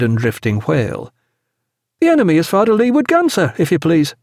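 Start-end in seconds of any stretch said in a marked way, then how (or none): 2.0-4.1 high, quick youngster's report; reporting midshipman. A narrator's reading. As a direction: rattle off a signal report in a boyish voice. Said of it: "reported the signal midshipman".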